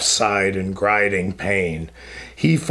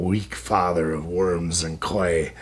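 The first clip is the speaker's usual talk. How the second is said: much lower and softer, heavy scorn; contemptuous low growl; 6 semitones lower, 6 dB quieter than usual. A narrator's reading. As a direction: sink the insult down to a dark hush. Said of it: "Los answered in his furious pride".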